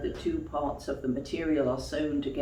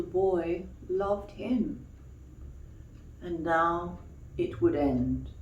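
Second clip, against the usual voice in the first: put-on gentle storybook reading for children; story narration; 3 semitones higher, 5 dB louder than usual. A narrator's reading. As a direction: tell it like a bedtime tale. Reads a bedtime story.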